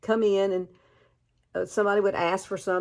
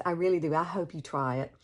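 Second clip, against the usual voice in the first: usual speaking voice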